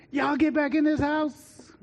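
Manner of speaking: high, slow calling-in voice; parent calling out. Retold or imitated. Parents call the kids back into the house, high and slow. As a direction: holler the kids back home.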